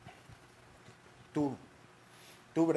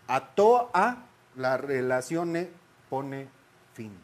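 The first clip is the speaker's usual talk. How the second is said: dramatic slow reading; 3 dB louder, slower than usual. Shown as a demonstration. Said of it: "Era un tono así".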